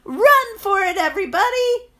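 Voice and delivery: high, loud alarmed shouting; panicked villagers. Acted. Villagers warn each other to flee, high loud yelling. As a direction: scream a warning to everyone.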